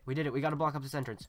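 ordinary talk, nothing marked

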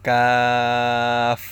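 long কাফ, slightly forward of the tongue root; slow, held demonstration of fronted কাফ; forward k letter. Shown as a demonstration. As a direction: stretch কাফ out loudly, made further forward on the palate. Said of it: "একটু সামনের দিকে আগিয়ে".

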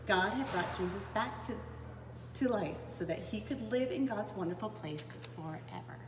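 usual speaking voice

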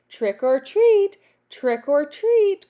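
high, loud singsong call. A narrator's reading. Witch summoning everyone to eat, calling out. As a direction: call it out, rising onto treat. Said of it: "called Naggy Witch".

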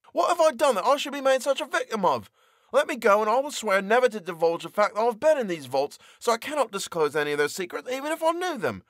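high, desperate plea; pleading prisoner. Acted. Prisoner begs the voice at the door, high and desperate. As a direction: beg to be let go.

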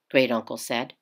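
ordinary delivery, nothing marked

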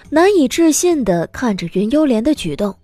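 usual speaking voice

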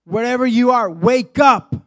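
calling the listener to rise; commanding call. Acted. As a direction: summon them up. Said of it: "Jesus is telling you today".

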